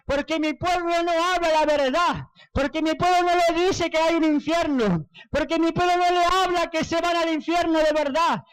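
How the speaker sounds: high, wailing lament in full preaching voice; retold weeping lament. The Lord laments his sleeping church, retold weeping lament. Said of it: "Llorando me decía el Señor".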